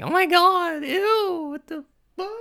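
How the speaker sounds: very high, mock-disgusted girl voice. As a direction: squeal it out like a grossed-out girl. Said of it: "they're like".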